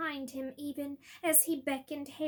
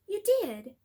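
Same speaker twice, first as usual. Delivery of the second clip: sharp, raised question; sharply; 5 semitones higher, 7 dB louder than usual. Acted. Dumbledore to Harry, a sharp surprised question. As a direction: react fast and sharp. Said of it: "said Dumbledore sharply".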